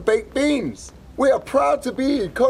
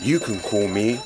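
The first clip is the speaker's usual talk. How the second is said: deep heroic voice; 14 semitones lower, 4 dB quieter than usual. Acted. Superhero name reveal, deep voice.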